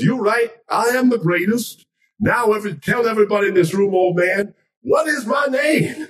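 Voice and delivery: very high, crowing champion voice; Muhammad Ali proclaiming. Acted. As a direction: make a grand boast to the room. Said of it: "Ali goes back to this guy and goes".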